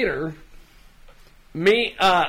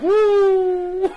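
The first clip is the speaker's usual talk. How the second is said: high whooping mock cheer, higher; retold excited woo; 11 semitones higher than usual. Imitating James cheering at the typecasting joke, whooping. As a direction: whoop like a delighted friend. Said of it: "James immediately like".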